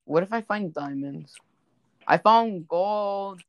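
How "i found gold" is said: slow, loud, thrilled drawl; excited. Real, not acted. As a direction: stretch it out in triumph. Minecraft find, drawn out.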